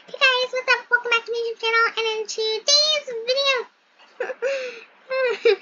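high-pitched preppy YouTuber hello; preppy YouTuber intro. Pretend. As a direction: greet the viewers like a preppy influencer. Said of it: "acting like I'm preppy".

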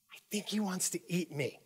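soft, scared confiding; mock fearful hush. Seatmate telling the attendant her fear, mock hushed. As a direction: murmur it fearfully.

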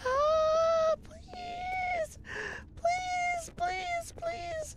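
high, crying, breathless pleading; trembling whisper. Real, not acted. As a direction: whimper please over and over. Begging while crying.

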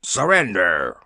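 ordinary delivery, nothing marked

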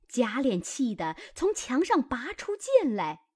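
usual speaking voice

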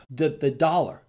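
ordinary talk, nothing marked

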